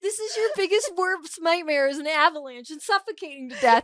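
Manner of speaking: high, loud disbelieving rant; incredulous. Real, not acted. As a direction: pile on the disbelief, half laughing. Incredulous teasing of friend.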